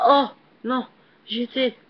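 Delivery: loud, high outburst of dismay; high, loud gamer's frustration; dismayed cry. Real, not acted. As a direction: cry out in protest at the failed jump. Fails the jump again.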